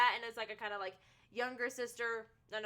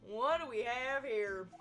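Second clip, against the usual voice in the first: put-on cowboy accent; 3 semitones lower than usual. Streamer voicing the marshal as a cowboy, cowboy accent. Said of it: "I was gonna say it better be a cowboy".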